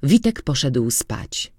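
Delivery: ordinary delivery, nothing marked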